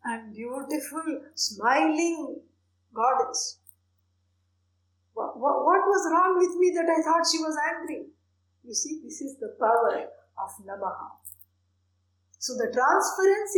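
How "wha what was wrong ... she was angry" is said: ordinary talk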